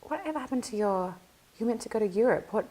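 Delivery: high, curious asking; quoting her own surprised question to a colleague; retold eager question. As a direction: lift the pitch and ask with keen interest. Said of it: "and I was like".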